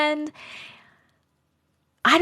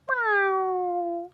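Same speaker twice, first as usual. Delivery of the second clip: high drawn meow, then a trilled yowl; cat meowing; 9 semitones higher than usual. Pretend. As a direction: mimic a cat calling. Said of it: "Did your cats like that one?".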